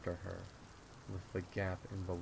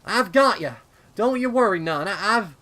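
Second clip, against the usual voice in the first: relieved shout; 12 semitones higher, 20 dB louder, faster than usual. Acted. Grabs sister, reassures her.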